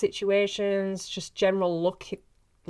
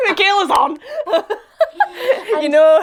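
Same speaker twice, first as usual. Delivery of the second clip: laughing out the words, louder and higher; 12 semitones higher, 10 dB louder than usual. Real, not acted. Laughing with her co-star about hearing her back. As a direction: giggle through it, delighted.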